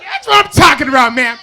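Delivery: high, loud burst of approval; excited shout. Real, not acted. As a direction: cheer her on at full volume. Celebrates audience member's high five.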